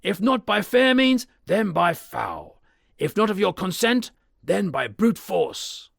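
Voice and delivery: high, loud, slow, each end hammered; loud threat. Acted. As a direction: threaten to break the door. Threat through the door.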